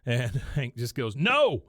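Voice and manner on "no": loud, high acted no; retold exasperated yell; shouted refusal. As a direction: shout it to shut the request down. Acting Hank flatly refusing.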